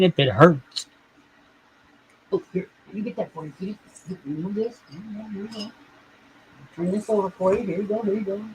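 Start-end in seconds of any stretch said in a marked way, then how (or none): 2.3-8.4 high, soft murmuring to the kitty; hushed high baby talk; soft pet talk. Acted. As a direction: coo quietly while helping the cat. Fussing over the cat softly.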